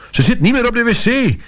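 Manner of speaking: high, panicked shriek of a fussy lady; shrieking woman. Acted. Old lady shrieks to the narrator that the girl vanished, in a high panicky voice. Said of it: "gierde ze".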